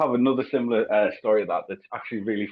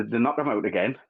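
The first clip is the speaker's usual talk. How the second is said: usual speaking voice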